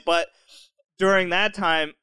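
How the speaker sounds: usual speaking voice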